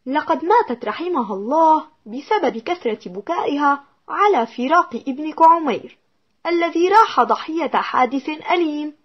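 ordinary delivery

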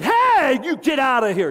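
shouting him out of the room; startled shout at an intruder; retold shout. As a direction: yell hey high and loud.

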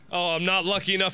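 normal talking voice

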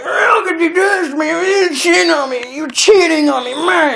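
hammed-up high accusing yell; mock shouting girlfriend. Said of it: "the woman's like".

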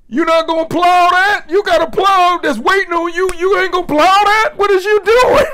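high, loud, incredulous shouting; hammed-up high shouting of "plow that"; mock outraged yell. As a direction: yell at him like a baffled friend. Acted Shakespeare scolding a man.

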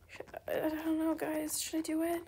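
hushed nervous second thoughts; whisper. Real, not acted. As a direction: murmur the doubt under breath. Nervous deciding aloud.

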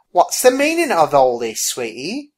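puzzled waitress. Acted. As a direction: start loud, soften on the endearment. Puzzled waitress questions Abigail.

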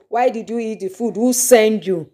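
ordinary delivery

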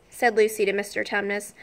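plain reading voice

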